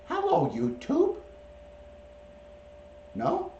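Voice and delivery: very high, slow, sing-song greeting; pet-coaxing voice. Shown as a demonstration. Owner modelling a greeting to YouTube for his bird, high coaxing voice. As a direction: draw it out high for a bird to copy.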